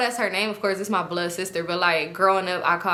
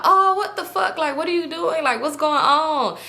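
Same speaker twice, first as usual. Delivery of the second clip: high-pitched mock freak-out; alarmed; 8 semitones higher, 3 dB louder than usual. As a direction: imitate someone shocked, pitched way up. Man sees her throwing up.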